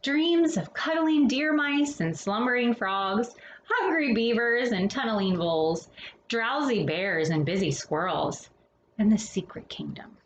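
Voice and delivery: gentle bedtime picture-book read-aloud; storybook reading. Acted. Reading a winter picture book to children, gentle storytelling.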